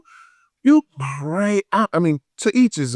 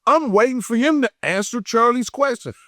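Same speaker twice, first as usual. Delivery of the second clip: ordinary delivery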